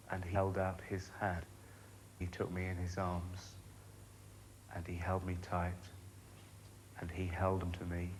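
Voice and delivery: soft, measured recollection with lingering pauses between phrases; tender, intimate. Acted. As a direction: savour each embrace, quietly and gently. Tender memory of being held.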